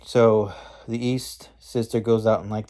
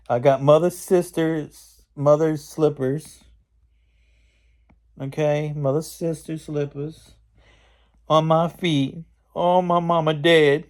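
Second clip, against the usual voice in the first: hammed-up lament in a raised voice; upset East Witch; 6 semitones higher, 4 dB louder than usual. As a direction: wail about it like a self-pitying witch. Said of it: "the East Witch is all getting upset".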